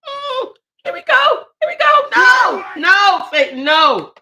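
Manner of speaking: breaking down, fighting it; real crying, shouting no; tearful. Real, not acted. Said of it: "So if I start crying".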